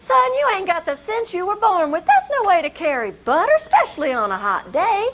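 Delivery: a light touch of a high scolding mama; gently fussing at a foolish son; scolding mother. Acted.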